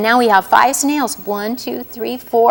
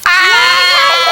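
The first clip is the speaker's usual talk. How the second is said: mock scream; 7 semitones higher, 5 dB louder than usual. Frog startled by gator.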